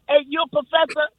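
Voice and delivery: normal talking voice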